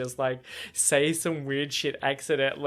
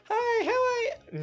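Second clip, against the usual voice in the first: squeaky cartoon mouse voice, very high and slow; Mickey Mouse; slower than usual. Cashier greeting a customer, accidentally in a very high, slow Mickey Mouse squeak. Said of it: "Why was I doing a Mickey Mouse impression?".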